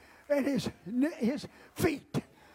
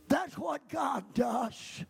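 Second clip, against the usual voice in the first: fiery preaching; 4 semitones higher, slower than usual. Acted. Preaching at full force.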